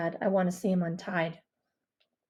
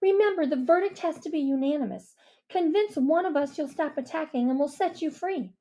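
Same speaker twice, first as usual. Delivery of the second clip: put-on stern girl voice; 7 semitones higher than usual. Mia voiced.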